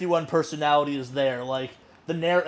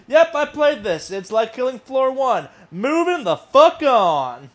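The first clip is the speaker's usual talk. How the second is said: higher, loud, unimpressed brush-off; dismissive; 7 semitones higher, 5 dB louder, slower than usual. Retold or imitated. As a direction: wave it off, bored. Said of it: "That was like".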